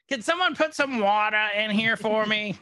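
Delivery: high, pleading creature voice; lizard lady voice. Acted. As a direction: play a small needy lizard asking for water.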